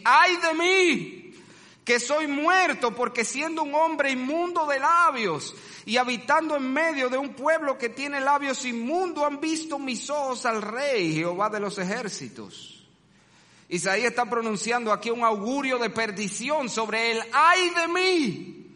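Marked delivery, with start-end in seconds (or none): 0.0-12.7 anguished lament, pitched high and loud; anguished cry. Acted. Preacher acts Isaiah's anguished cry. 17.3-18.7 high, slow wailing "ay de mí"; drawn-out lament, high and loud; anguished cry. Acted. Repeats Isaiah's cry.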